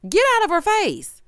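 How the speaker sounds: high, emphatic telling-off; exasperated high-pitched command; sharp scolding. Real, not acted. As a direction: raise the pitch and order him away. Repeats the telling-off, escalated.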